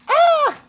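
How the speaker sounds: sudden high, loud yelp; startled shriek. Real, not acted. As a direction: yelp sharply as if nearly nipped. Startled while near the biting bird.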